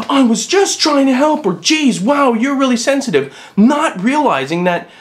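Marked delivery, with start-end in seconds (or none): 0.1-1.4 high, whiny mock innocent; mock clueless offender. Vlogger mocking people who miss social cues, high and whiny. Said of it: "they're like". 1.5-3.4 high, sneering mock dismissal; mock dismissive offender. Vlogger mocking someone who calls others sensitive, sneering. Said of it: "they're like".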